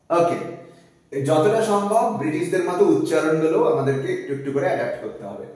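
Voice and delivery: ordinary delivery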